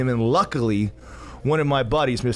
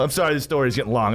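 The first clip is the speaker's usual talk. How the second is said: ordinary delivery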